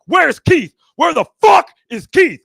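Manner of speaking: loud, high, furious mock demand; angry shouting. Dealer at grandmother's door hunting dad, angry shouting. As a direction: shout it like a debt collector.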